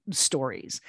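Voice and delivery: usual speaking voice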